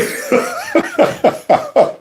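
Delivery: real high-pitched giggling laugh; high laughter. Real, not acted. Host cracking up over an unknown congressman, high laughter. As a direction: crack up at your own jibe. Laughs at his own remark.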